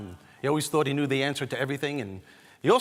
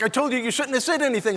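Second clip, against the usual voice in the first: scolding brother; 11 semitones higher, 5 dB louder than usual. Retold or imitated. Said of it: "My brother's like".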